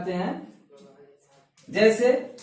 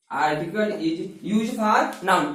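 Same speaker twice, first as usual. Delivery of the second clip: dictation; 3 semitones lower, much slower than usual. Shown as a demonstration. Dictating the definition.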